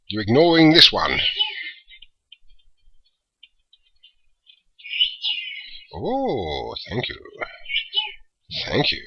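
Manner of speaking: booming overlord barking orders at minions; loud commanding villain voice; big deep voice. Acted. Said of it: "big, deep voice".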